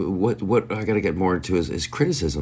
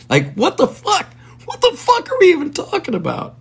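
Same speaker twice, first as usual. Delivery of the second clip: much higher, disbelieving outburst; incredulous. Real, not acted. Podcaster baffled by his own treaty scenario, squeaking in disbelief. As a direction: pitch shoots up in total disbelief.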